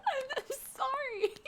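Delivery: sorry, said through giggles up high; laughing apology. Acted. She apologizes to her date through laughter. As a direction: apologize but can't stop laughing.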